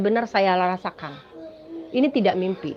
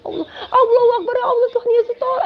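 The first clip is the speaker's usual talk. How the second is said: high tearful begging, much higher; frightened crying plea; 12 semitones higher, 4 dB louder, faster than usual. Retold or imitated. Trapped dreamer begging God for help, crying. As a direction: cry out to God in panic. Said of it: "kita semua ketakutan".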